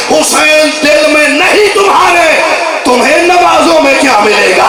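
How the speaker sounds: building from firm verse to high, ringing shout; full-force rhythmic declamation to the crowd; impassioned majlis recitation. Acted. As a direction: drive the refrain into a long-held devotional cry. Full-force recitation ending in chant.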